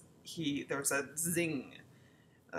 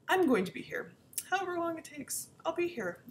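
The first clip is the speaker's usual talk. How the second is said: mock earnest vow; 13 semitones higher, 5 dB louder than usual. Ex's bold vow voiced.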